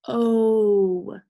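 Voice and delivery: "O" held long and loud; long O sound. Shown as a demonstration. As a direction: sing out a steady, stretched "O". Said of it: "Now I've got the sound O".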